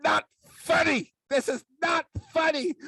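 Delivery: high, indignant scolding shout; hammed-up angry mom; mock mad mother. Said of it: "my mother got mad".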